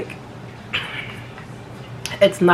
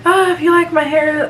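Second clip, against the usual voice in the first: loud, high burst; 10 semitones higher, 5 dB louder than usual. Real, not acted. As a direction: call it out loud. Sudden loud outburst to viewers.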